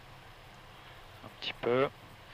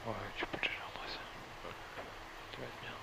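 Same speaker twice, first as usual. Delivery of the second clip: whispered aside; 2 semitones lower, 18 dB quieter, faster than usual. Real, not acted. Aside to the student mid-approach.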